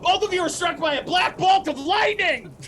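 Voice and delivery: loud, high game master's big reveal; dramatic announcement. A narrator's reading. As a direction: announce the lightning bolt like an epic cliffhanger. Dramatic cliffhanger narration.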